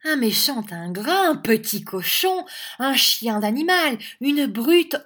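high, loud volley of insults; angry exclaiming. A narrator's reading. Schoolmaster's wife cuffing the boy at each epithet, angry exclaiming. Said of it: "s'écria madame Squeers".

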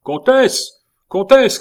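very high, ringing shout; loud cry. A narrator's reading. A husband calling the countess back, loud cry. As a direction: call her at the top of your voice. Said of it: "cria la voix".

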